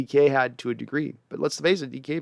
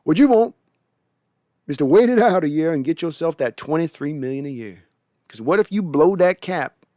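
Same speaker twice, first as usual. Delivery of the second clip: put-on higher, louder old grandpappy voice; 4 semitones higher, 4 dB louder than usual. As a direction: lecture him like a worried grandpa. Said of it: "that's why I'm doing this voice".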